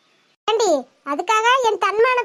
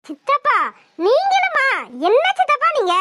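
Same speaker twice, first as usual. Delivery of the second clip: shocked disbelief; 4 semitones higher than usual. Acted. Niece betrayed by her uncle.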